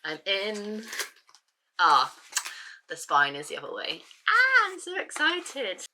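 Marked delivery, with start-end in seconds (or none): 4.3-5.9 high, slow, drawn-out squeal of joy; excited squeal. Real, not acted. Unboxing long-awaited book.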